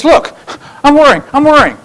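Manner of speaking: high, put-on fretful boy; hammed-up Linus. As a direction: overact the worrying in a high kid voice. Linus hamming up his worrying.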